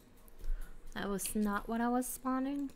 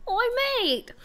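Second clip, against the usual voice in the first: high, loud mock-English shout; butchered English accent; 12 semitones higher, 5 dB louder than usual. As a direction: call out in a badly faked English accent. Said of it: "I'm really butchering it".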